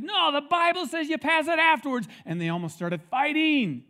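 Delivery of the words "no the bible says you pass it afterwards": much higher, louder contradicting retort; arguing deacon. Retold or imitated. Rival deacon contradicting across the room, high heated retort. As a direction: fire back a heated correction. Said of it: "somebody else on the other side said".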